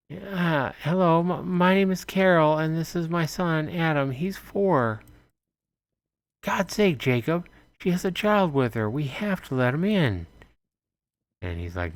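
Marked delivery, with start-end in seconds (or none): 0.1-5.0 pitched-up mother pleading politely; high woman's voice. Acted. Voicing Carol introducing herself. 6.4-10.3 pitched-up wife pushing back; wife urging husband to open door; exasperated woman. Acted. Voicing Anna arguing.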